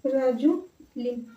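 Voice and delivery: soft, broken into stretched syllables; quiet syllable-by-syllable spelling-out; slow dictation. Real, not acted. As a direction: sound it out slowly while writing. Saying the word while writing it.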